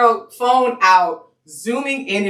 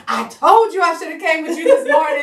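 high-pitched fussing mom; imitated indignant mother, pitched high; mom storming in; 11 semitones higher than usual. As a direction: burst in scolding, voice way up. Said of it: "My mom comes storming in".

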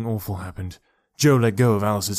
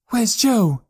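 high, louder cry of alarm; frantic cry; 12 semitones higher, 5 dB louder than usual. A narrator's reading. Girl searches frantically for lost Joe, frantic cry. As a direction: cry out in sudden panic. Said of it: "cried Alice, looking around frantically".

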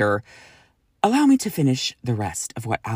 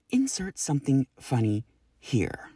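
breathy whisper, softer; whispered aside; 6 dB quieter than usual. Retold or imitated. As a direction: drop to a whisper for the joke. Reading listener's joke aside.

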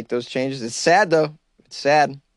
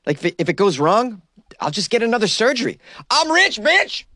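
climbing higher into a shrill boast; mock quote rising to a squeal; 8 semitones higher than usual. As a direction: build from mild to squealing I'm rich, bitch. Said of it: "He says".

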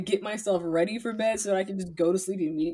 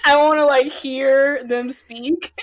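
exasperated laughing; 5 semitones higher, 7 dB louder, slower than usual. Retold or imitated. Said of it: "and I'm like".

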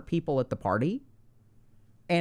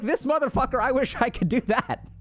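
higher, mock envious grumble; envious anxious onlooker; 6 semitones higher than usual. Anxious party guest envying a bold stranger, mock grumble. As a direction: imitate a jealous anxious guest. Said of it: "So they're like".

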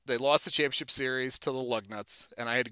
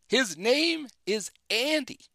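mock yell; 9 semitones higher, much slower than usual. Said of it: "He yells".

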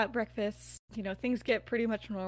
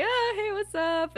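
high, drawn-out mock greeting; friendly greeting; 11 semitones higher, 5 dB louder than usual. Mary greeting Lily from seats away, perky. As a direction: imitate a perky hello, stretched and higher.

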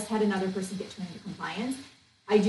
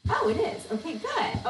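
pleasantly surprised; 8 semitones higher than usual. Real, not acted. Surprised at search result.

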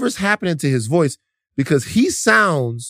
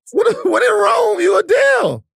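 hyped shout. Acted. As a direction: shout it like a hype man. Hyped comic declaration.

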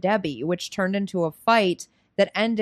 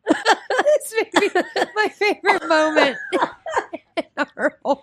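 much higher, squealing through laughs; helpless real laughter; 10 semitones higher, 3 dB louder than usual. Real, not acted. Co-host delighted by the rating reasons, laughing helplessly. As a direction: barely get the words out laughing.

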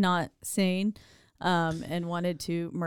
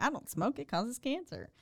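high, soft prim cop voice; high quiet mock voice; 6 semitones higher, 8 dB quieter than usual. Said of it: "And he's like".

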